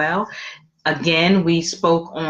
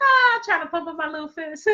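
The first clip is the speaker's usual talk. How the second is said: laughing; 11 semitones higher, faster than usual. Real, not acted. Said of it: "We just being silly".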